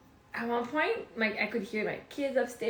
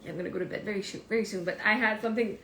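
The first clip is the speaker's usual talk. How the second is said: normal talking voice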